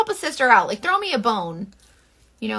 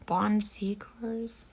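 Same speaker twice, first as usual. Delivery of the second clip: whisper; 10 dB quieter, much slower than usual. Real, not acted. Aside to someone off camera.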